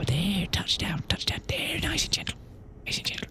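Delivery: whispered, careful landing; whispering. Real, not acted. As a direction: breathe the words out as you ease it down. Gently landing the ship.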